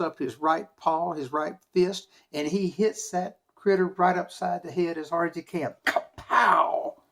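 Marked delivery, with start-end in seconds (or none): 5.9-7.0 loud storyteller kapow; punch sound effect. A narrator's reading. Storyteller acting out Brer Rabbit's punch, loud kapow. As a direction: bang it out like a punch.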